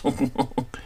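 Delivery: soft, dismissive laugh; mocking chuckle. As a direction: chuckle quietly at a rival's expense. Mocking rival as clout chaser.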